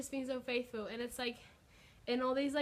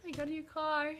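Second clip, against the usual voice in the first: higher, bright celebratory yay; real joyful celebration; happy cheer; 5 semitones higher than usual. Real, not acted. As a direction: cheer it out to the viewers. Celebrating new car at the end.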